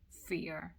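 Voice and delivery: usual speaking voice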